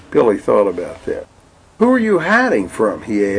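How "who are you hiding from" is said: put-on child voice. Billy the child asks.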